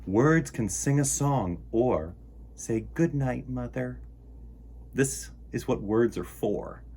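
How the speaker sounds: put-on lively storytime read for kids; reading a picture book aloud. A narrator's reading. Host reading a word book to children, lively storytime voice.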